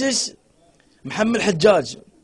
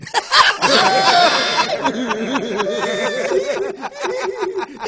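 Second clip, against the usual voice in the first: high laughter; 10 semitones higher than usual. Real, not acted. Laughing at joke.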